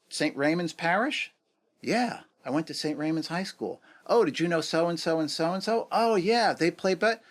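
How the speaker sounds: high-pitched, animated reenacted chat; excited recognition. Two New Yorkers finding shared schools, excited recognition. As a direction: act out delighted discovery of common ground. Acted discovery of shared background.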